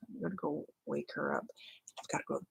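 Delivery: hushed whisper so the dog can't hear; whispering so the sleeping dog stays asleep. Real, not acted. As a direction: whisper it, someone's asleep nearby.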